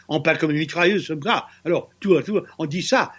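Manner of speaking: rattled-off imitation of Parisian French; mock rapid-fire Parisian French; fast Parisian French. Shown as a demonstration. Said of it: "was a very fast, uh, Parisian French".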